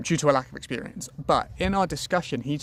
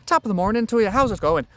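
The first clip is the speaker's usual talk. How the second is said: quick, higher, louder Irish lilt; Irish accent; 6 semitones higher, 4 dB louder than usual. Shown as a demonstration. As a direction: put on a brisk, high Irish accent. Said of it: "speech is rather fast, so, like, Irish".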